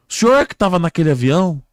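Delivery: high, loud storybook questioner; rescuer questioning. Acted. Man questioning a crash victim, loud and high. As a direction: call the question out to him. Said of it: "o homem chegou pra ele e falou".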